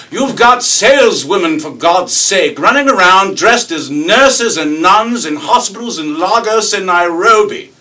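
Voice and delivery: high, shouted British tirade; mock-indignant rant, building to a bellow; sputtering outrage. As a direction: sputter like a boiling kettle, imitating an irate young Brit. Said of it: "he sputters like a boiling tuber".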